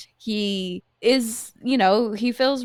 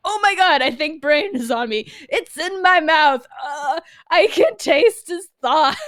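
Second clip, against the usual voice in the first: panicked; 5 semitones higher, 4 dB louder than usual. Retold or imitated. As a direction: babble in mock panic. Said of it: "my panicked thought process".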